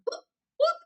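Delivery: high squeaky whoop whoop; mock tic whoop. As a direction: yelp it suddenly like a tic. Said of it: "I'm just making up something now".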